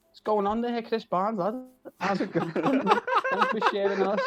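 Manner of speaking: high, loud Scouse greeting. Retold or imitated. Greeting the famous singer by message, in high, broad Scouse. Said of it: "you said that so Scouse".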